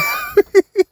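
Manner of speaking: high-pitched laughing fit. Real, not acted. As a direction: laugh at your own joke, pitched high. Laughs at own trucker description.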